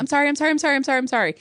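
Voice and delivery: high, rapid-fire mock-crying apology; imitating tearful pleading. Little girl apologizing to her mother, mock-crying. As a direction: imitate a wailing child begging forgiveness. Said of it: "crying really hard".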